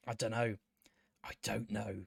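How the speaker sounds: real whisper of disbelief; whispered under breath. Real, not acted. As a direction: breathe it out, defeated. Baffled mutter after repeat.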